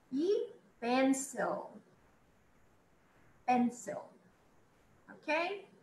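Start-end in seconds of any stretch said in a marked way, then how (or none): 0.1-4.0 drawn-out pencil, teacher model; slow model word. Shown as a demonstration. Teacher models pencil slowly for students. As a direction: say E pencil slowly for class to copy. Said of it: "teacher models word slowly".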